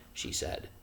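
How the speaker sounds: ordinary delivery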